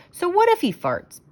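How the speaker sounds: put-on high child voice shrugging it off; a boy's cheeky so what; high-pitched boy brushing it off; kid's defiant question. A narrator's reading. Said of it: "Billy said to Betty".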